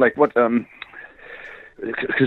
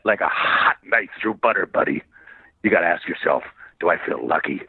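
gravelly Wolverine voice; 5 semitones lower than usual. Retold or imitated. Wolverine voice continuing.

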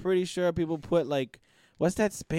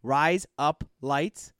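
slow model phrase; much slower than usual. Shown as a demonstration. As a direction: RISE UP LIGHTS said slowly, word by word. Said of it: "really fast".

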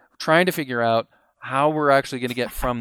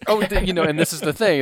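normal talking voice

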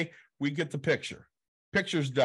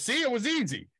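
much higher, pleased-with-himself voice; smug quote; 10 semitones higher than usual. Retold or imitated. Kevin Smith bragging about the spelling, high smug chirp. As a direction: chirp it like a proud kid. Kevin proudly misspells name.

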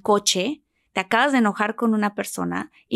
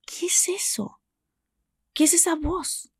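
hushed, higher whisper to herself; inner whisper; 6 semitones higher, 6 dB quieter than usual. Retold or imitated. Recalling washing dishes, she whispers her inner question. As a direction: breathe the question as a startled thought. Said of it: "de repente yo dije".

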